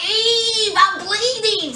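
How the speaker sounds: hammed-up crying from the bleeding man; mock crying plea. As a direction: play the wounded man begging her not to go. Wounded Hugo begging Eve.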